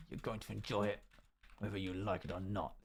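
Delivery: low, soft mock threat; mock ominous. Echoing a viewer's message as a threat, mock ominous. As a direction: drop low and quiet, as if menacing. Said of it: "Very ominous way of putting that".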